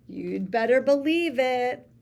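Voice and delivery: ordinary delivery, nothing marked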